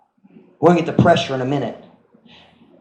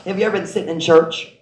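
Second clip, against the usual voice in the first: ordinary talk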